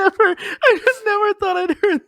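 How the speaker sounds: high, squealing laughter through the words; helpless laughter. Real, not acted. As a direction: barely get the words out for laughing. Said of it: "stop laughing".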